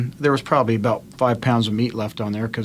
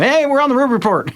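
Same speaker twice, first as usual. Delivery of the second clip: high, bright announcer voice, much higher; mock radio host announcing; 11 semitones higher than usual. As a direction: play it up like an on-air host. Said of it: "I'm in the bathroom talking".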